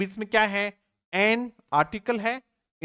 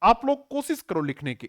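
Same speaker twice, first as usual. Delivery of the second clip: normal talking voice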